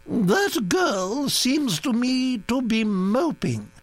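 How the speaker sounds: put-on high, brisk aristocratic old lady; old duchess voice. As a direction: gossip like an imperious old duchess. Duchess remarks on the girl.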